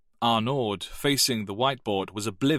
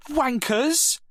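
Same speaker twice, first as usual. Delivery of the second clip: a very high, loud insult spat out; furious girl; 5 dB louder than usual. Acted. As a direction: hurl the word at the boys.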